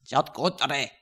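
low, hard-edged mock German; badly faked German accent; attempted German accent. Co-host trying nationalities on one word, mock German.

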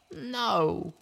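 high, anguished pony cry of loss. Acted. Little Pip crying out at her ruined cutie mark, anguished. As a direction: cry out as if something precious is torn away.

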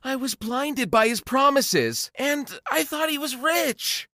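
high, pleading apology; desperate gold digger voice; pleading ex-girlfriend. Acted. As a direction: beg him back in a high, small voice. Ex begs forgiveness.

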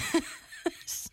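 breathless silent laugh; wheezing laugh. Real, not acted.

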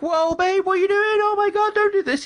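very high, hammed-up panic; mock panicked husband. Husband pleading with chainsaw wife, very high mock panic. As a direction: squeal it like a terrified husband. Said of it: "he's not like".

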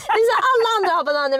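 high, quick laughing words. Real, not acted. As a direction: talk through the giggles. Laughing, excited.

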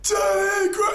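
high, loud yell, cut short; heckling shout. Acted. Drunk mate heckles again ten minutes later, cut-off shout. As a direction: yell the heckle, stopping mid-name. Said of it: "one of our mates just shouts".

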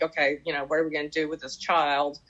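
ordinary talk, nothing marked

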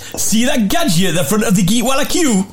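Geordie accent. Shown as a demonstration. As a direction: read it as a Newcastle local.

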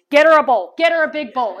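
lightly imitated urgent command; loud order. Kitchen woman to the others, loud order. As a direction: call it out, loud on the first order.

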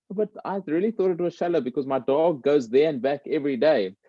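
hint of a puzzled little boy explaining; innocent boy.

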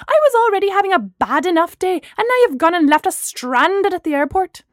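loud, high-pitched indignant tirade; angry shouted rant. A narrator's reading. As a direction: let the outraged voicemail rant pour out. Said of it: "she shouted into the mouthpiece".

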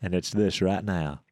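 low, slow Southern actor drawl; Matthew McConaughey. Pretend. Actor character delivers word to Kira, slow low drawl. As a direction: stretch it out, low and laid-back. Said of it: "Matthew McConaughey".

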